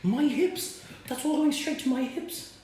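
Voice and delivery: high mock whine about the hips; fretting over the hips in a high mock wail; mock fretting dieter. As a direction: ham up a panicked calorie-counter, pitched high. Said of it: "so don't be going".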